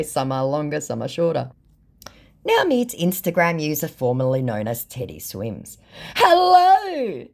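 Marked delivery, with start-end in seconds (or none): 6.1-7.3 high, louder sing-song greeting; chirpy fake-friendly hello; put-on scammer voice; mock scammer voice.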